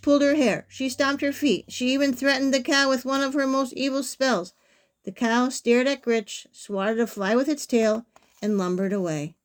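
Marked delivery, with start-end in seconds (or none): none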